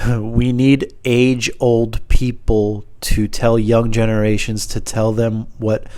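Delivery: slow, word-by-word recital; drawn-out, portentous reading; deliberate note reading. Pretend. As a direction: read the nonsense out slowly and carefully. Reading nonsense note aloud.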